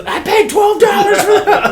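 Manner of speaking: high, loud mock yell through laughter; laughing as he retells Rob's outburst. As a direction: crack up while quoting it.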